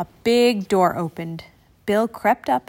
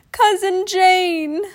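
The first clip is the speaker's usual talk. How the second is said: much higher, louder and slow; the name bursts out in alarm; alarmed realisation; 10 semitones higher, 5 dB louder, much slower than usual. A narrator's reading. Bill realising his cousin is captive, slow alarmed cry.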